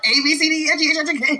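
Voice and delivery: high, excited letter rattle; hyped-up child recital. Pretend. Imagined hype child reciting the alphabet, mock excitement.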